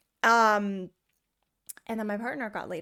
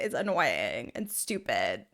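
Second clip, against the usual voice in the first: mock deep man voice; 10 semitones lower, slower than usual. Shown as a demonstration. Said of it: "When a man does it".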